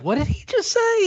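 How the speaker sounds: very high-pitched incredulous question; baffled disbelief. Retold or imitated. Viewer reacting to a line he had to rewind, baffled disbelief. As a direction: squeak it out, totally baffled.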